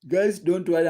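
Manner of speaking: mid-yawn; talking through a yawn. Real, not acted. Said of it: "I'm yawning".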